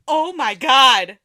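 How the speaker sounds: loud, drawn-out starstruck exclamation; re-enacted shock, very loud; stunned disbelief. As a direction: belt it out slowly in amazement. Re-enacts shock at being recognized.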